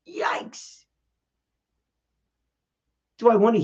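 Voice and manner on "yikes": whispered yikes. Real, not acted. Reaction to harsh feedback.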